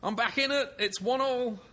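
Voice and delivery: high, sing-out whoop of triumph; jubilant. Real, not acted. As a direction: crow happily about catching up. Celebrates winning a quiz point.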